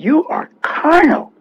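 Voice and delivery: lightly voiced apostle, spelling out carnal slowly; Paul's rebuke. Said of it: "Paul said".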